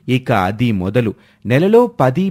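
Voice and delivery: ordinary delivery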